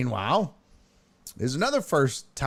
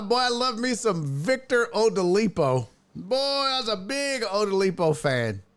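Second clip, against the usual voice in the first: high, gushing pretend fan; hammed-up enthusiasm, pitched up; mock fandom; 7 semitones higher than usual. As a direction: gush over the misspelled name to tease. Teases someone over misspelled name.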